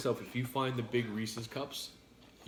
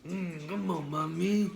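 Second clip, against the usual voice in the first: higher-pitched mock Spanish accent; put-on accent; 4 semitones higher, slower than usual. Trying an accent on co-host, mock Spanish come-on. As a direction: coax in a hammed-up Spanish accent. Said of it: "How's my accent?".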